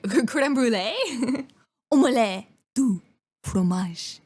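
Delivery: crème brûlée slow with mock French vowels; hammy French menu; French food words. Shown as a demonstration. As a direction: roll out omelette, du fromage in French. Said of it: "the French, like, they teach you".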